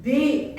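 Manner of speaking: BIG said loud and full. Shown as a demonstration. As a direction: make BIG sound big. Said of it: "sometimes the voice also".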